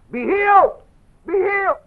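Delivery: very high, loud mock yell; yelling healer. Loud minister yells healing at the sick, mocking shout. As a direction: bellow it like a faith healer. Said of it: "they get so loud ... and they yell".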